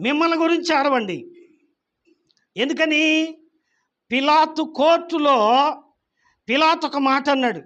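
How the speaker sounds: fervent preaching. Acted. Preacher urging the congregation, fervent. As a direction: drive the point home.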